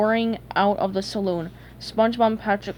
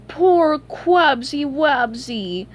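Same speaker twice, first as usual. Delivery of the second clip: put-on slow, higher baby-talk sympathy; pretend pity; 5 semitones higher, 4 dB louder, much slower than usual. A narrator's reading. Plankton pretending to pity his rival, mock sympathy. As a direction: coo mockingly, stretching the words. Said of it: "pretending to feel sorry".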